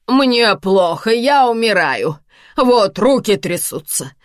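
loud, high, drawn-out wailing complaint; lamenting. A narrator's reading. Karina tells Samvel she is dying, lamenting loudly. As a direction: lament theatrically as if at death's door. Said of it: "причитает Карина".

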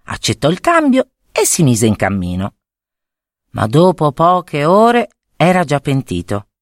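usual speaking voice

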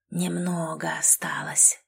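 soft, slow whisper; impatient whisper. Acted. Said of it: "шептал Дрим дрожащим от нетерпения голосом". (нетерпеливый шёпот)

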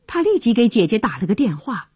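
ordinary delivery, nothing marked

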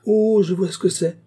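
high, loud, knowing "oh"; scornful realisation. Acted. Housekeeper deciding the visitor is a beggar, loudly scornful. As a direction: pounce on it with loud disdain.

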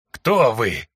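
rasping, gurgling, barely human; hoarse gurgling voice. Acted. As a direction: croak it out as if speech is forgotten. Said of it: "Хриплый, булькающий голос". (хриплый булькающий голос)

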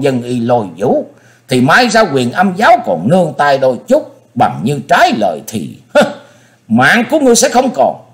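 tiger roar. Acted. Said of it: "cất tiếng như hổ gầm". (gầm như hổ)

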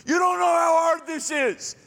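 high shouted whine; shouting complainer. Retold or imitated. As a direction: wail it at the friend. Acted whiny complainer quarrel.

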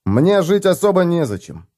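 plain reading voice

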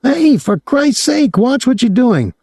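put-on high, excitable young man's voice; a gawking kid, pitched high; young Claude, leering. A narrator's reading. Claude yanked by hair.

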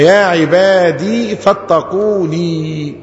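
slow "عبادي فاتقوني" with long final ee on each; both ya sounded. Shown as a demonstration. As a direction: restore and stretch both endings. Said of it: "حذفنا الياء دي وحذفنا الياء دي". (إثبات الياءين مع المد)

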